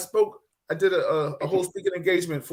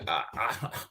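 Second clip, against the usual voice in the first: stammering teacher; 7 semitones lower, 10 dB quieter than usual. Retold or imitated. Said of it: "Teacher was like".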